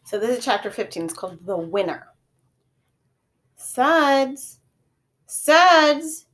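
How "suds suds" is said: loud high call from far off; distant calling. A narrator's reading. Mother calling her son from far away, loud call. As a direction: call the name across a distance. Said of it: "I heard a voice calling me".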